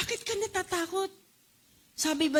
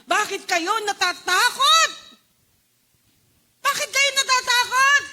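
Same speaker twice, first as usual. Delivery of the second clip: loud, high questioning rebuke; Jesus rebuking his frightened disciples; booming rebuke; 8 semitones higher, 8 dB louder than usual. Acted. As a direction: challenge them loudly. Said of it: "At ang sinabi niya".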